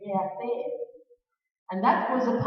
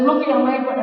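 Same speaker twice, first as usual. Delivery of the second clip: excited; 4 semitones higher, 9 dB louder than usual. Retold or imitated. Shows dad the shirt, loudly.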